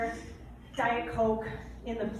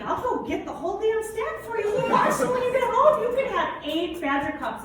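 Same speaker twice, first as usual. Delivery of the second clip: high, louder mock sarcasm; teasing offer; 10 semitones higher, 5 dB louder than usual. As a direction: needle him playfully. Teasing Dave about cups.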